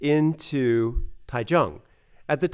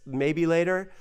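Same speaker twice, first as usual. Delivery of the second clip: ordinary delivery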